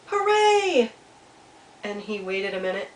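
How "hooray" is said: high, joyful drawn-out cheer; excited shout. A narrator's reading. Little Beaver shouting across the pond, excited shout. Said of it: "so excited, he shouted".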